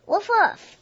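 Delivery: two high barks; happy puppy woofs; eager dog bark. Acted. Dog barking in story.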